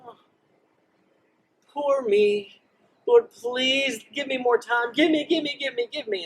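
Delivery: high, whiny mock begging; whiny pleading. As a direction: beg like a spoiled child, give me give me. Sick Hezekiah begs for time.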